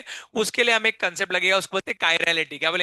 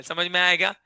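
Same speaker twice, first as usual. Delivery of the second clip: ordinary talk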